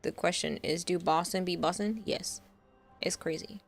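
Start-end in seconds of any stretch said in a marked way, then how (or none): none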